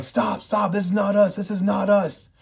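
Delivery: high, urgent plea to stop; pleading crowd. Retold or imitated. Rioting crowd urging each other to stop, high urgent plea. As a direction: cry out high to halt the riot.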